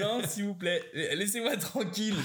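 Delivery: pleading refusal. Retold or imitated. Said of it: "je dis".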